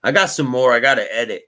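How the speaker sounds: ordinary talk, nothing marked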